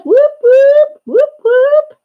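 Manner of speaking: high, loud whoops; whoop cheer. Real, not acted. Welcoming tiny pufferfish, loud high whooping cheer. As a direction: cheer like at a party. Cheering Becky's daughter arrives.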